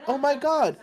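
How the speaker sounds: loud, very high-pitched imitation; mock alarmed character. Mocking a game character spotting someone, high mock alarm. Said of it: "now he is kinda like".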